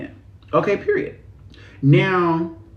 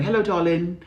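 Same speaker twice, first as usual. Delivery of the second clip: posh British "Hello, darling", pitched up; playful British accent impression; British girls; 4 semitones higher than usual. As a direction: imitate a posh British greeting. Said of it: "when the British girls be like".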